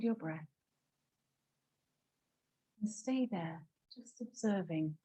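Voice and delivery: soft, hushed meditation voice with long pauses; guided meditation. Real, not acted. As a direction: guide them quietly into watching the breath. Leads a breathing meditation.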